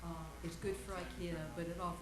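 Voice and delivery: faint, distant off-mic voice; speaking away from the microphone; far from mic. Real, not acted. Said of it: "It may not be on".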